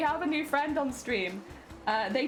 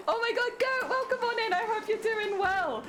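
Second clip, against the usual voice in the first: high greeting voice; 9 semitones higher than usual. Pretend.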